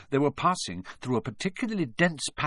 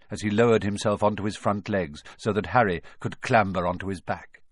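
plain reading voice

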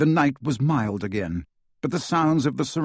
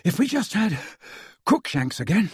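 high, winded, broken phrases; out of breath from running; panting; 6 semitones higher than usual. A narrator's reading. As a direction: gasp it out between breaths. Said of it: "panted Ron".

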